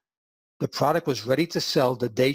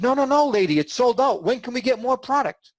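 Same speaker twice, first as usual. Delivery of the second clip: higher and louder, hyped-up store clerk; excited clerk; 10 semitones higher, 4 dB louder than usual. Retold or imitated. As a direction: gush at her like an eager clerk who's just sold out. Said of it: "And the clerk says".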